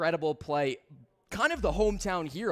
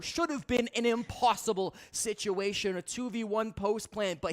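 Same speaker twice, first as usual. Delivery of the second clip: esports caster; 4 semitones higher, 6 dB louder, slower than usual. Real, not acted. Caster hypes after the clutch.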